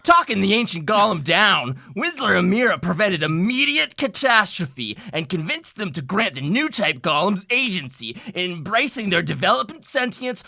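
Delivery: loud, high dramatic recap; story recap narration. Acted. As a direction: narrate the recap with grand energy.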